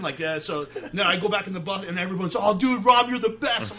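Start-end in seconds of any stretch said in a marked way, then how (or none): 2.4-3.7 excited praise. Retold or imitated. Bandmates cheering him on.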